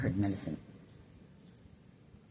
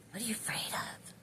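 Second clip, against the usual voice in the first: breathy whispered question, higher pitch; whispering; 8 semitones higher, 8 dB quieter than usual. Retold or imitated. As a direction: whisper it like a secret voice. Channeled message, repeated whispered.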